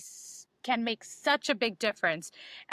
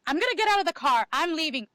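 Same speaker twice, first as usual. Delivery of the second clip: quick, raised, louder acted announcement; mock agitated exit, rushed and higher; agitated walk-out; 4 semitones higher, 4 dB louder, faster than usual. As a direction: blurt it out as if about to storm off. Acted triggered walk-out from the car.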